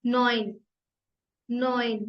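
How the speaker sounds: नॉइन said "noin" with an oi; नॉइन repeated for learners; German neun. Shown as a demonstration. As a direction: model नॉइन clearly. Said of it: "नाइन".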